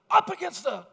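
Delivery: sudden loud high order; the officer barks the order at him; cop command. Retold or imitated. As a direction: snap out the first words like a cop. Cop orders him against truck.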